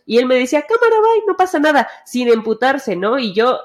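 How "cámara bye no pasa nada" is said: put-on high, loud, breezy "whatever" voice of the boyfriend; imitating boyfriend. Boyfriend brushing off his girlfriend mid-game, mock carefree high voice. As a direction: toss it off carelessly in a much higher voice.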